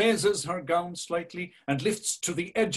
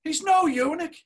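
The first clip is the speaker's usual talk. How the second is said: much higher, louder mocking taunt; jeering high voice; 12 semitones higher, 5 dB louder than usual. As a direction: sneer it out like a spiteful imp. Mocking voice jeers at Bloom.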